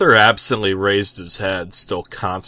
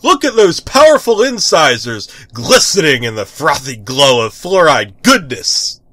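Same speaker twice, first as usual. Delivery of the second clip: loud, grand superhero voice; heroic declaiming; 6 semitones higher, 8 dB louder than usual. Acted. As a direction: proclaim it proudly. Hero declaiming over toothbrushing.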